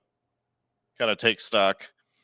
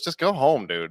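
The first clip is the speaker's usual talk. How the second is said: higher, louder mock dismissal; retold brush-off; 5 semitones higher, 4 dB louder than usual. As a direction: imitate a bouncer waving someone off. Bouncer dismissing yelling kid, retold.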